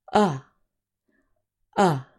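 a said as "uh", the vowel of cup; short U. Shown as a demonstration. Teacher modelling the last short vowel, short U. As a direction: sound out a as a short "uh", twice. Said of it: "the last short vowel sound is".

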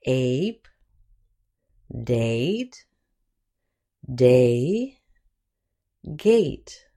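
Ape, date, day, gate, each alone with a long "ay"; long A examples. Shown as a demonstration. Teacher giving example words for the long A, careful modelling. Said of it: "Here are some example words".

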